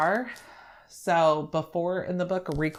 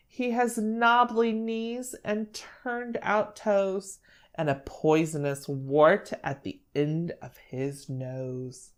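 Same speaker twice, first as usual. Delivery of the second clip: slow, sing-song storybook reading; measured picture-book narration with lifted phrases; story reading to children; 5 semitones higher than usual. Acted. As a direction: read it to a small child, stretching the describing words. Reads picture book aloud.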